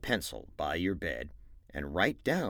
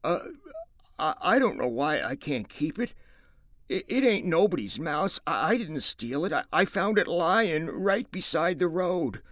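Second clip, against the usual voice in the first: higher, stammering, close to tears; voice breaking; 10 semitones higher, 6 dB louder than usual. Acted. Lenny to George, pleading tearfully to keep the mouse. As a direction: plead like a hurt child. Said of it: "His voice broke a little".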